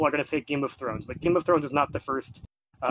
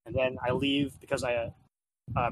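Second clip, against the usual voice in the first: usual speaking voice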